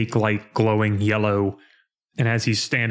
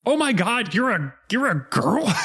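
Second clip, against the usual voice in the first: high-pitched, hammed-up astonishment; mock shock; 11 semitones higher than usual. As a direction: gasp out the discovery in mock disbelief. Acted quote of shocked characters.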